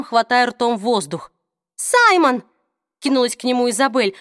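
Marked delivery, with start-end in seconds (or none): 1.8-2.4 high, loud cry of alarm. A narrator's reading. Isabel crying out as Simon doubles over in pain, alarmed. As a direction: call his name out in fright.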